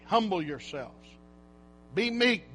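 ordinary delivery

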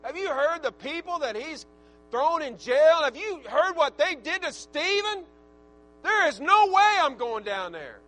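pleading Ananias. Retold or imitated. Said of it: "he's probably negotiating with the Lord".

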